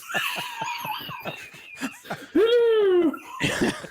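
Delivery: high squealing laugh; shrieking laugh. Real, not acted. Cracks up at accent joke.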